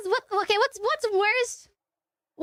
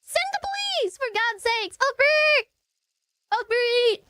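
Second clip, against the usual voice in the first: hammed-up cry for help, pitched way up; mock desperate plea; 2 semitones higher, much slower than usual. Caller begging dispatcher for help, mock desperate.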